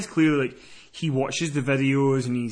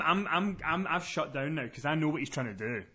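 normal talking voice

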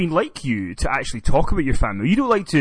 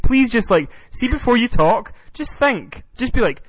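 exasperated plea; 10 semitones higher than usual. Real, not acted. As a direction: beg them to think, voice raised high. Exasperated plea to co-host before recording.

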